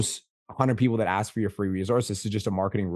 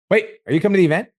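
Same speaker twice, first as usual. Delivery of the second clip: jumps up high and louder, just remembered; sudden surprised question; 11 semitones higher, 7 dB louder, faster than usual. Real, not acted. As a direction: blurt it out as it hits you.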